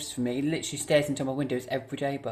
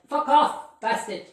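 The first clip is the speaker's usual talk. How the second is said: shout; 11 semitones higher, 4 dB louder, slower than usual. Real, not acted. Yelling at the ghost.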